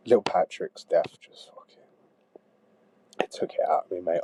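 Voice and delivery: breathy whispered confession; grieving whisper to a friend; hushed grief. Acted.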